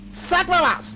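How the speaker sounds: sudden high, loud yell; real outburst mid-game; angry shout. Real, not acted. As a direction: blurt it out in a rage at the screen. Gaming outburst at enemy.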